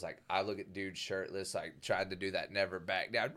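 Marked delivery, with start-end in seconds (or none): none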